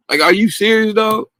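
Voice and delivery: high "are you serious, dog"; can't believe it; incredulous. Real, not acted.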